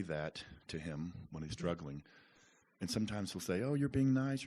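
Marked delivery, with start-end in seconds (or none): 3.6-4.5 higher, louder voice imitating his son; son brushing off praise. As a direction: lightly mimic a kid dismissing a compliment.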